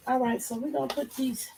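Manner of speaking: usual speaking voice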